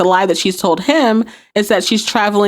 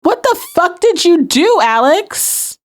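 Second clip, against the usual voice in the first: restrained retold yell, pitched way up; mock yelling; 10 semitones higher, slower than usual. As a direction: imitate calling out in outrage. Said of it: "yelling out to Alex".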